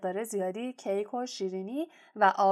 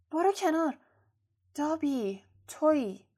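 mildly startled, higher-pitched exclamation; 6 semitones higher than usual. A narrator's reading. Harry finding Dobby in the dark, mildly startled. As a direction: react with a touch of alarm on waking.

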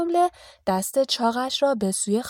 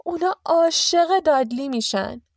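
high, louder, thrilled aunt; delighted; 8 semitones higher, 5 dB louder than usual. A narrator's reading. Petunia thrilled by her son's rehearsed welcome, delighted. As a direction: gush with motherly delight. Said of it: "خاله پتونیا که به وجد آمده بود گفت".

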